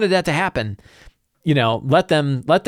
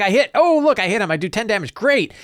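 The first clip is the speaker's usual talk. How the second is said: higher, gleeful mock cheer at the hit; excited player; 6 semitones higher than usual. Player cheering his polearm hit on the orc, gleeful and higher. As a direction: play the thrilled player landing a blow.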